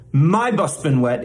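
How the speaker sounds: "my bus been wet" with the weight on "my"; wrong stress on MY. Shown as a demonstration. Mock teacher shows a common error, stressing "my". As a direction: punch "my" and let "bus been wet" fall away. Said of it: "put the stress on the wrong syllable".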